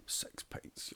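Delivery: breathy whispered "six pints". Retold or imitated. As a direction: whisper it like a barmaid confirming. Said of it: "Woman's like".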